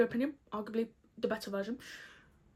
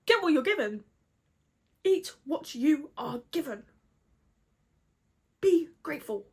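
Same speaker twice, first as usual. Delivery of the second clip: put-on louder, higher nagging parent telling off a child; mock stern scolding; 4 semitones higher, 4 dB louder than usual. Scolding Jeremiah for refusing the peaches, mock stern. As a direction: bark it like a cross mum at a picky eater.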